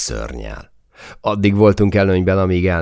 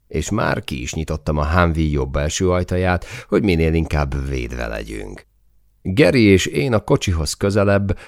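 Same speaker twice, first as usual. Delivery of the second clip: ordinary talk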